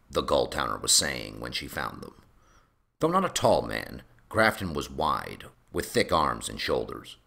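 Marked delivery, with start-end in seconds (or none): none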